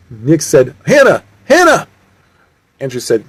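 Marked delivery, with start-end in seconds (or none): 0.8-1.9 high, urgent shout of a name; acted high-pitched cry; calling out. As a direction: call a friend's name in alarm. Calling out to endangered friend.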